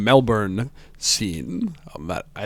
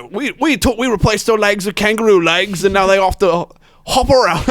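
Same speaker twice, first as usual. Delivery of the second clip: high, hammed-up Australian accent; excited mock-Australian YouTuber bragging; 8 semitones higher, 4 dB louder than usual. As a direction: put on a broad Australian twang and push it up high. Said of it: "that's my Australian accent".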